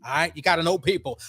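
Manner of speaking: ordinary talk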